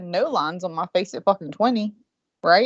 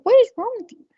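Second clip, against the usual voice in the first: much higher, startled question; high alarmed mom; 13 semitones higher, 4 dB louder than usual. Retold or imitated. Mom asking her daughter what is wrong, high and startled.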